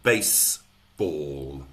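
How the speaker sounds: baseball in two slow, separate beats; syllable by syllable. Shown as a demonstration. Teacher counting syllables for trainees, slowly syllable by syllable. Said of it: "So two syllables".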